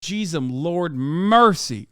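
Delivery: ordinary delivery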